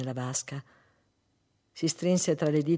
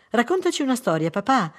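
put-on high, loud, quick child's plea; eager little boy; 8 semitones higher, 7 dB louder than usual. Son jumping on father's knees, eager childlike pleading. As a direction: pipe up excitedly like a small son.

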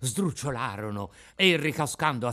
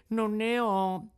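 meek puppet voice; 8 semitones higher, slower than usual. Acted. Puppet signals no money.

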